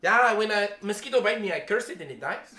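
put-on high, animated Filipino English accent, telling a tall tale. The Filipino pastor brags about commanding a cloud, high Filipino accent. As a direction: retell the story as the Filipino pastor in his accent. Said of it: "he used the Filipino accent".

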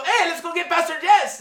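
high, loud mosquito voice in Filipino English; Filipino accent. Retold or imitated. Mosquitoes plotting to bite the pastor, loud high Filipino accent. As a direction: play a mosquito calling its friends, Filipino accent. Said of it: "he used the Filipino accent".

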